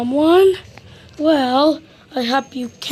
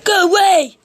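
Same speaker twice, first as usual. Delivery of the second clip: loud, higher yell; shout; 6 semitones higher, 6 dB louder than usual. A narrator's reading. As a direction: bark them off from behind the door. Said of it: "shouted Mister Root".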